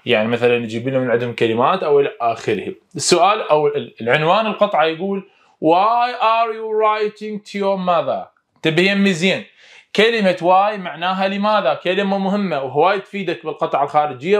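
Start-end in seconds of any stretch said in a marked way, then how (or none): 5.6-8.3 slow, careful English reading; teacher modelling slowly; slow model reading. Shown as a demonstration. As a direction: read it out slowly for the class to copy. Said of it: "يلا وياي".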